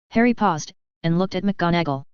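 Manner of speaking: ordinary delivery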